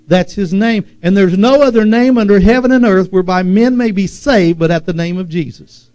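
emphatic, driving declaration of the name; forceful preaching. Real, not acted. Preacher declaring the name of Jesus, forceful preaching. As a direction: preach it in insistent, building phrases.

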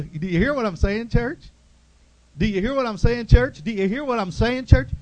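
rally the congregation with rising calls; preaching at full force. Real, not acted. Preacher calling to the church to listen, loud and rousing.

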